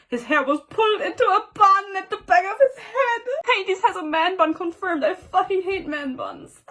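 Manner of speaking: high, wailing mock despair; hammed-up dismay. Real, not acted. Reader discovering Hades has a man bun, hammed-up dismay. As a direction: read it as if it pains you.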